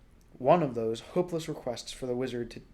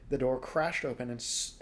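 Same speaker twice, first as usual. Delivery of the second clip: ordinary delivery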